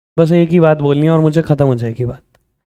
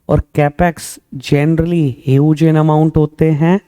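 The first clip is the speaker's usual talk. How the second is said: slow emphasis; much slower than usual. Shown as a demonstration. Teacher stressing key definition slowly.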